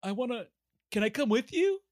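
high pleading little voice; pleading dog voice. Retold or imitated. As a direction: beg to come along, high-pitched. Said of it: "Fred's just like".

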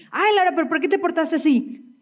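reclamo agudo, levemente imitado; esposo reclamando. Retold or imitated. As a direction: imita a un esposo que regaña, con la voz más aguda. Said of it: "Mi esposo me dice". (esposo que reclama, en tono fingido)

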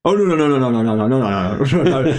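rapid panicked nos; flustered backpedalling. Retold or imitated. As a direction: backtrack in a hurry, no no no. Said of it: "And it's like".

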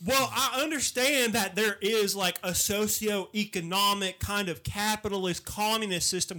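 earnest Southern country orator. Acted. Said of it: "A redneck that's really up to date".

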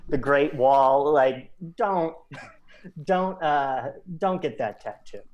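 ordinary delivery

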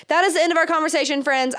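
breathless pregnant talk; running out of air, rushing; out of breath. Real, not acted. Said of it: "I'm so out of breath".

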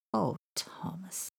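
slow, breathy tender sigh; soft, drawn-out affectionate voice; touched. Acted. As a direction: sigh the name fondly. Touched by brave little boy.

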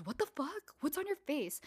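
high-pitched retold disbelief; acted shock. Friend seeing the girl's cheek, retold shock. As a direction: imitate an appalled friend, voice jumping up.

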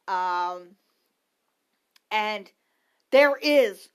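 ordinary delivery

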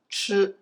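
吃 with a curled-tongue "chr" sound, high; standard 吃 sound. Shown as a demonstration. Teacher shows learners the hard sound, 吃 as retroflex "ch". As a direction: say 吃 as a drawn-out retroflex "ch". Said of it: "この発音が".

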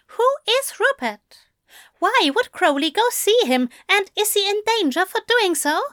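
high, worried rush of questions; anxious. A narrator's reading. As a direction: fire off questions in alarm.

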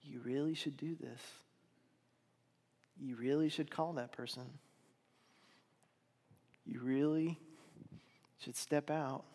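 soft, hushed nudge; small inner voice. Acted. The inner voice urging a listener to act, hushed. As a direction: gently urge like a quiet conscience. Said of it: "but also the voice that says".

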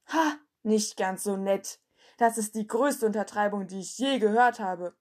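lauter spöttischer Ausruf, langsam; höhnisch über das Gesagte rufen; rufend. A narrator's reading. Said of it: "rief einer von ihnen". (Schüler ruft laut und höhnisch)

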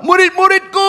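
hammed-up cry of anguish; mock lament. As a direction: wail it high, holding the last syllable. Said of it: "Atau kalau".

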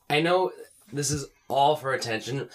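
ordinary talk, nothing marked

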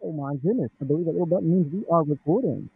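boomy, muddy sound; muffled bassy voice. Real, not acted. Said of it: "you sound super muddy".

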